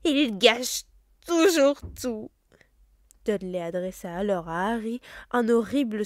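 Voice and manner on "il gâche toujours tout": loud, whining mock sobs, slowed; fake sobbing. Dudley wailing to his mother, loud fake sobs. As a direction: bawl like a spoiled child faking tears. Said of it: "d'une voix secouée de faux sanglots".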